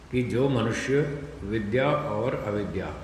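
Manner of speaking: ordinary talk, nothing marked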